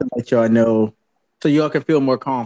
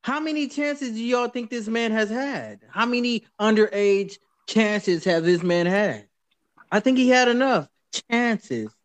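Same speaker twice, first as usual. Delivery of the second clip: indignant; 8 semitones higher, 4 dB quieter than usual. Real, not acted. Outraged at defense of Kelly.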